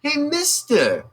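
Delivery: high, slow mock customer voice; customer greeting. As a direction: call out like an eager little customer. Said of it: "by saying it's like".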